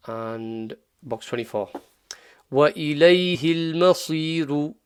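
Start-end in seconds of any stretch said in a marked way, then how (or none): 2.5-4.7 وَإِلَيْهِ الْمَصِيرُ recited with a heavy sad; full-mouth sad. Shown as a demonstration. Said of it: "Sad is full mouth".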